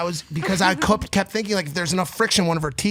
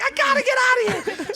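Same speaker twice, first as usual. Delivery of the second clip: much higher whiny imitation of a panicked girlfriend; high mock girlfriend. Boyfriend mimicking girlfriend trying to leave at night, high and whiny. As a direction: squeak it up high like a frantic girlfriend. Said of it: "she's like".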